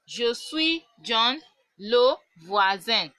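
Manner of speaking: each syllable stretched slowly and clearly; slow teaching demo. Shown as a demonstration. Teacher to students, slow clear model sentence. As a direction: say it slowly for the class to copy. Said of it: "You say".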